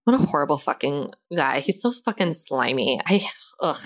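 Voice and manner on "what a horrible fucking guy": normal talking voice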